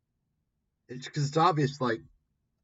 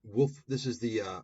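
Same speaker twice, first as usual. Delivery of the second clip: ordinary talk, nothing marked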